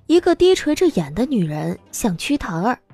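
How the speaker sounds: ordinary talk, nothing marked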